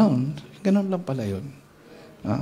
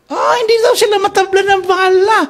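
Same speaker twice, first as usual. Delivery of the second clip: high, loud boastful taunt; hammed-up rebel boast; mock angry rebel; 6 dB louder than usual. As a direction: taunt like a bragging gunman. Voicing the angry Abu Sayyaf.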